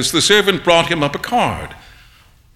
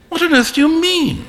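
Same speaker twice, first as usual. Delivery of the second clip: much higher, incredulous; sudden high-pitched surprise; astonished exclaim; 13 semitones higher than usual. A narrator's reading. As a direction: jump up in disbelief. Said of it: "exclaimed Hughie".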